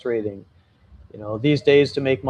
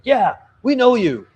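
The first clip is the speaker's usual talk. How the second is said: high, louder eager confirmation; excited agreement; 10 semitones higher, 5 dB louder than usual. Retold or imitated. Boyfriend chiming in at the campfire, eager and high. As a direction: jump in keen to back her up.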